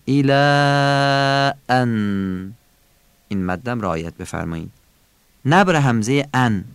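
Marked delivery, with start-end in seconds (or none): 0.1-2.5 الا ان very slowly, higher, the aa held long before ان; chant-like hold on the end of الا, then ان; long madd. Shown as a demonstration. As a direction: stretch الا right out, then land on ان.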